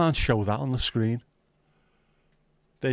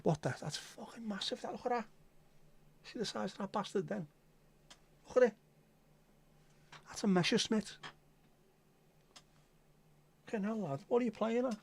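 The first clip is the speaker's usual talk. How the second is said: hushed amazement; 11 semitones higher, 11 dB quieter than usual. Real, not acted. Huge insect spotted in room.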